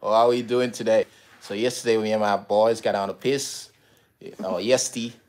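put-on Australian bloke voice; Aussie accent. Partner shows off for wife's viewers, Aussie accent. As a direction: play up a matey Australian accent. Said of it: "Give him an Aussie accent".

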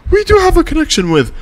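high, quick mock protest; protesting fan parody. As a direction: squeak it back like an indignant fan. Mocking Swifties' retort.

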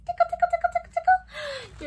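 very high squeaky tickle-tickle; giggly baby-talk tickle; playful tickle. Pretend. Tickling baby knees game.

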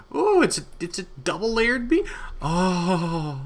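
sudden high-pitched surprise; surprised. Real, not acted. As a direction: squeak out in disbelief. Discovers double beacon.

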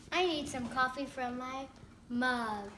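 normal talking voice

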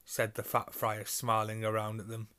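usual speaking voice

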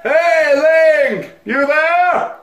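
high, hollered game character; loud call. Acted. Farmhand calling out to Link, loud holler. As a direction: shout it across a field. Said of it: "Oh jeez, that was loud".

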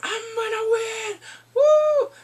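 high, drawn-out crowing; hammed-up winner's squeal; mock triumphant cheer. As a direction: crow it high, then a loud whoop. Retold player boasting he'll win.

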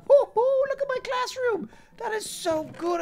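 very high, excited squeal; giddy delight. Real, not acted. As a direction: squeal with glee showing off something new. Delighted by the new classroom.

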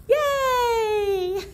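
loud, high yay; excited celebration; cheer. Real, not acted. Cheer after song.

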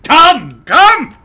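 two high, explosive yells; imitated command shout. Capping co-host's word with the gag, commanding shout. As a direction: bellow it twice as a running gag.

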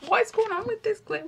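giggling in disbelief at the mess; laughing exasperated question. Real, not acted. Laughing at glue mishap.

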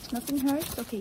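normal talking voice

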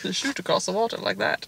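quiet mock movie-star voice; Sean Connery. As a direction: toss off a hint of his Scottish accent. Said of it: "The other being Sean Connery".

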